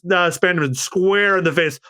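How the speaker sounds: usual speaking voice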